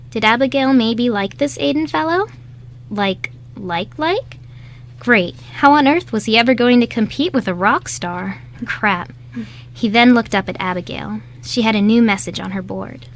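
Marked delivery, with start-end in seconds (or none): none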